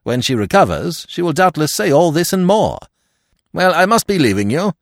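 high, loud parting remark; brisk Stoner. Acted. Stoner as a figure of the story.

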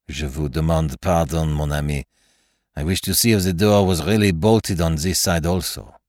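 put-on courteous French detective, English with French accent. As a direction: apologise politely in a French accent. Poirot's dialogue in narration.

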